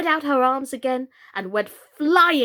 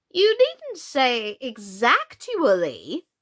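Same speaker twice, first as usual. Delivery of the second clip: slow, prim correcting queen; fussy correction; 2 semitones higher, 4 dB louder, much slower than usual. Acted. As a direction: correct her primly.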